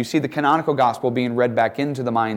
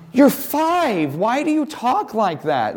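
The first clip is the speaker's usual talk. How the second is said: incredulous father; 11 semitones higher, slower than usual. Retold or imitated. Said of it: "Like, you know".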